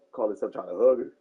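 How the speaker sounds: ordinary delivery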